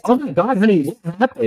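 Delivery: high, alarmed imitation of the husband; shocked husband. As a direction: gasp it out as if seeing a disaster. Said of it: "he goes".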